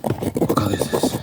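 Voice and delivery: forceful voiceless ASMR whisper. Pretend. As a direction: whisper the eye-test question firmly. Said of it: "I'm whispering because it's an A S M R video".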